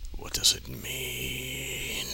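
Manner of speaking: slow, soft whispered question; scared whisper. Acted. Shadow asks the teacher in a slow scared whisper. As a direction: breathe it out slowly, frightened. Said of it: "Shadow asks in fear".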